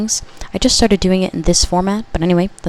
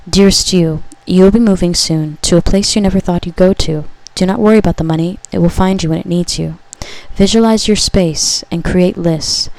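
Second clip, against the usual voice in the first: louder, solemn message to the listener; channeled message reading; 5 dB louder than usual. Acted. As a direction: read it as a loving prophecy. Reads second channeled message.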